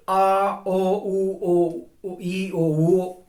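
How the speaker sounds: vowels intoned on a level pitch; steady demonstration of pure vowels; basic vowel tones. Shown as a demonstration. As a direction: sound out each vowel evenly. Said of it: "are basic tones".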